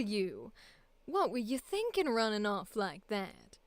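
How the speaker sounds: audition with a Southern lilt; Southern accent. Acted. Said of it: "Southern and then my own".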